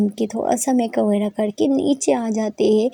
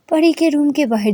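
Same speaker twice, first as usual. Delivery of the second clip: ordinary delivery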